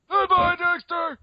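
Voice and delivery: very high, loud, slow cartoon farewell; piercing cartoon Dexter. Host answering as next Dexter, piercing loud cartoon voice. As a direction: push the cartoon goodbye up to a loud squeal. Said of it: "all the Dexters saying goodbye to each other".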